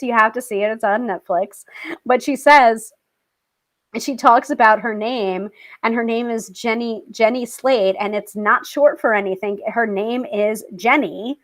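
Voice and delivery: ordinary delivery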